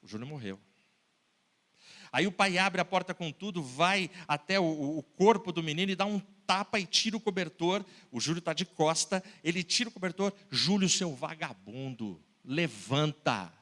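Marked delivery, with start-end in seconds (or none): 0.0-0.6 low, soft, grave aside; solemn refrain. Acted. Preacher reminding the crowd the boy is dead, low and soft. 10.5-13.5 low, slow, drawn-out scold. Acted. Father scolding the boy in bed, low and slow.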